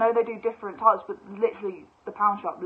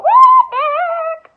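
much higher, louder slow shriek; shocked; 17 dB louder, much slower than usual. Retold or imitated. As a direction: shriek out disbelief. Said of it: "And I was like".

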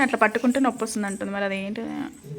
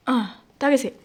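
ordinary talk

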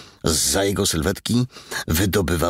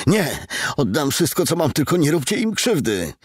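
much higher, pleading for his family; desperate pleading; 12 semitones higher than usual. A narrator's reading. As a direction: beg them in panic. Father begs for family.

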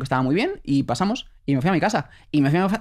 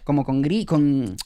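usual speaking voice

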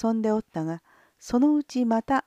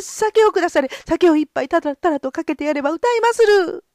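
flustered panic; 6 semitones higher, 5 dB louder than usual. Acted. Gonzo panics before the lord.

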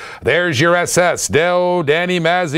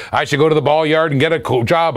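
normal talking voice

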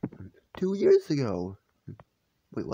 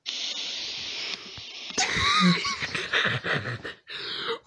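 laughing scream. Real, not acted. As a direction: burst out in a squealing laugh. Reacting to own old video.

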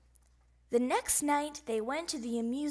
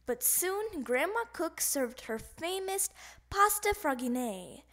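plain reading voice

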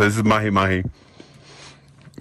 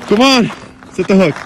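loud, high call to act; excited urging; 7 semitones higher, 6 dB louder than usual. Real, not acted. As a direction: shout at him to strike now. Urging friend to set hook.

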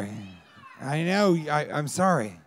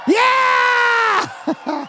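long, high-pitched shout; excited yell; 7 dB louder than usual. Pretend. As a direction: hype up a crowd with a drawn-out scream.

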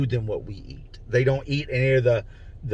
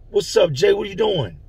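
pitched-up inmate voice; Malcolm, raised and friendly; higher Malcolm voice; 8 semitones higher than usual. Retold or imitated. Malcolm answers from his cell.

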